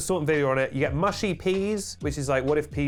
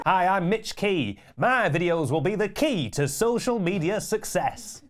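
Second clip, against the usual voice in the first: slow, hammed-up self-introduction; drawn-out, over-pronounced sales pitch; mock promo pitch; 4 semitones higher than usual. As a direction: play a cheesy social media guru. Acts out a fake-sounding name's pitch.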